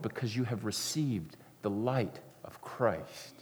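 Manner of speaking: soft, intimate sermon voice; tender preacher's appeal; hushed preaching. Acted. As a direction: press it home gently. Quiet climax of the sermon.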